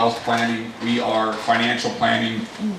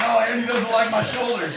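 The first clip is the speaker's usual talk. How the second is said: whiny, higher mock sob; mock crying; 9 semitones higher than usual. Joking to the room about Andy's comment, mock crying. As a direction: pretend to whimper it like a hurt kid. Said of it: "In the gym crying".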